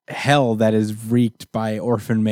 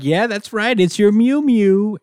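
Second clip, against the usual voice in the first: high cooing Nanny; 9 semitones higher than usual. Retold or imitated. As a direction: coo down at the baby in your arms.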